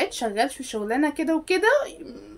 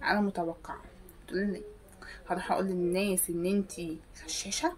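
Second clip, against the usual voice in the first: ordinary delivery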